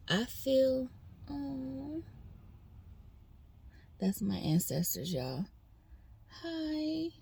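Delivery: fighting back tears; real tears breaking through; crying. Real, not acted. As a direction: voice breaking with tears, high and soft. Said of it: "I told myself I wasn't gonna cry on here".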